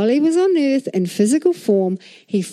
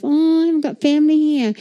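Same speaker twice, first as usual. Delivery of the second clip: self-pitying quote; 6 semitones higher, faster than usual. Retold or imitated. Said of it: "I used to feel sorry for myself".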